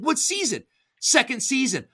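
high, eager quick exchange; both voices pitched high and keen; excited questioning. Retold or imitated. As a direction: fire it off, thrilled. Rapid retold back-and-forth.

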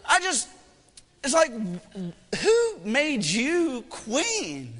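very slow, high, incredulous scolding; mock indignation. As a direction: drag it out in mock outrage. Dad mock-scolds his picky daughter.

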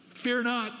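FEAR lifted high in proclamation; proclaimed high. Real, not acted. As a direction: ring out FEAR not. Preacher proclaims key command.

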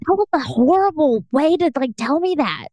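high-pitched, mildly exasperated scolding; retold freak-out. Girlfriend scolding boyfriend for horrible lead up, retold freak-out. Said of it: "I was freaking out".

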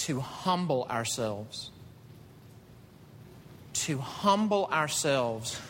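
slow, deliberate weight on humble; slow right down to let it sink in; leaving the congregation with the key phrase, slow and weighty; slow emphatic repeat. Acted. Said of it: "repeated key phrase, slowed".

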